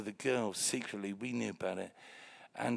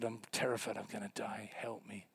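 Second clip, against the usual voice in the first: faint plea; 3 semitones lower, 7 dB quieter than usual. Retold or imitated. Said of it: "He said".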